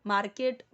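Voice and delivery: मार्केट with a hard spoken r; loud मार्केट, r sounded; wrong pronunciation. Shown as a demonstration. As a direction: say मार्केट as "maar-ket", stressing the r. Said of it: "park नहीं".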